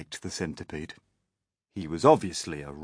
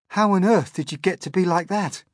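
curious question; 12 semitones higher, 8 dB louder than usual. A narrator's reading. Question in character's raised voice.

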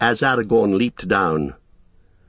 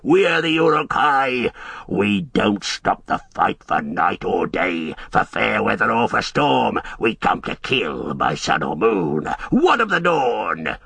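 jeering orc. A narrator's reading. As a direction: bellow the name, then sneer as an orc. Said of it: "they jeered".

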